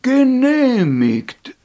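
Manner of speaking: slow, loud, drawn-out call; loud proclamation. Acted. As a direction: call it out grandly. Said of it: "rief der gelbe Alte".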